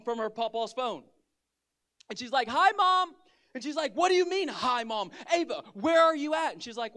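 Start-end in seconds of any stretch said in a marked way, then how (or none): 2.5-3.1 put-on high, loud little-kid greeting; imitated carefree child. Daughter phoning her mom, imitated carefree child. 3.9-6.5 high, loud retold outburst; mock exasperated mother. Mother to missing daughter on the phone, mock exasperation.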